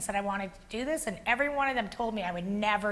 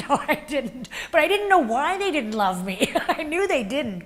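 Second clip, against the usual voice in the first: laughing through the words, higher and louder; 5 semitones higher, 5 dB louder than usual. Real, not acted. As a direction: say it while still laughing at yourself. Laughing at her own past self.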